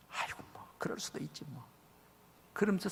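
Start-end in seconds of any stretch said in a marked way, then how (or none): 0.1-1.6 sly whispered shrug. Retold or imitated. People shrug off their vows.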